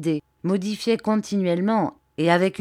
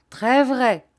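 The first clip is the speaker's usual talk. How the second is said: hearty agreement; 7 semitones higher, 5 dB louder than usual. A narrator's reading. Shepherdess approving.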